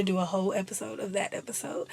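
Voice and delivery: quick whispered aside. Real, not acted. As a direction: whisper it like a secret. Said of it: "whispered aside".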